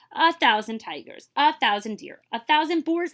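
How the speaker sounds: plain reading voice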